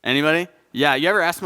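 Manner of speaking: normal talking voice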